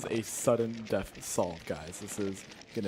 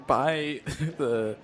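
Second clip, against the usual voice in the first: esports caster; 3 semitones higher, 6 dB louder than usual. Real, not acted. Live match commentary.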